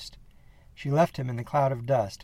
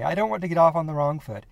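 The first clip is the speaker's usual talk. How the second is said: higher, louder nervous rush to make amends; smooth it over after a gaffe; flustered; 6 semitones higher, 5 dB louder than usual. Acted. Flustered apology, self-introduction.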